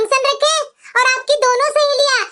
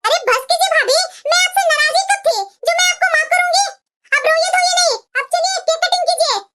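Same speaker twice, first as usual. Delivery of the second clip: higher, bright, laughing it off; cheerful sister-in-law; 5 semitones higher than usual. Acted. Sister-in-law waving off bhabhi's apology, higher and cheerful. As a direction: brushes away the apology with a smile.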